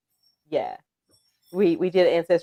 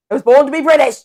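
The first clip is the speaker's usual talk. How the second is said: loud, higher British proclamation; emphatic British accent; 5 semitones higher, 11 dB louder, faster than usual. Shown as a demonstration. Claiming a British birthright, loud mock British accent. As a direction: declare it grandly in mock British. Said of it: "It sounds better".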